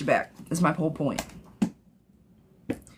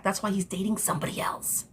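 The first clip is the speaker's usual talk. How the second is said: breathy unvoiced whisper, much softer; 6 dB quieter than usual. Real, not acted. Tarot reader whispering the hard truth to a viewer.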